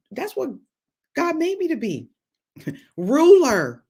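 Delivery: preacher rejoicing in her calling; exultant. Real, not acted. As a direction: build up and crow the last word. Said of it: "proud declaration".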